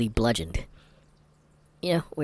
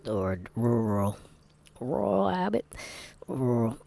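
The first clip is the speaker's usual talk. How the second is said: Southern accent; slower than usual. Shown as a demonstration. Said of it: "Southern accents because I am partially Southern".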